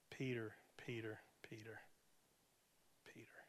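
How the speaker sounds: whispered name fading to nothing; hushed whisper. Retold or imitated. Jesus to Peter shaking his head, fading whisper.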